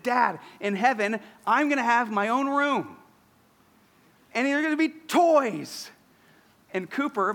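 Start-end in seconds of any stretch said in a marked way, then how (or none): 0.0-5.9 lightly voiced eager little boy, rising higher and louder; mock toddler excitedly listing his wishes; little son. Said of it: "but he said".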